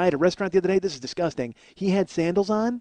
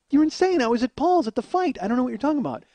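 acted-out flustered denial; defensive protest; 8 semitones higher than usual. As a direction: insist on your innocence, pitch raised. Retells defending himself to his wife.

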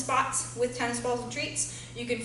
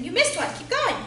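encouraging dog; 8 semitones higher, 4 dB louder than usual. Real, not acted.

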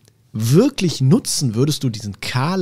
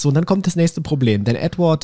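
usual speaking voice

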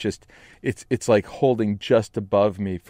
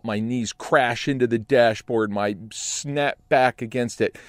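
ordinary talk

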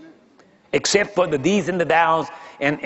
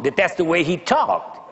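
ordinary delivery

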